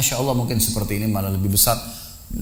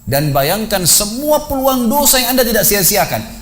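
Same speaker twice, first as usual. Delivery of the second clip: high-pitched, insistent preaching; stern exhortation; 7 semitones higher, 3 dB louder than usual. Retold or imitated. Preacher confronting a man posing as a corpse with his sins, stern exhortation. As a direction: drive the warning home, raised and urgent.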